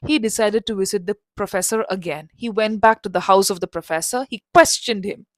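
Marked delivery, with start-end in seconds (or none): none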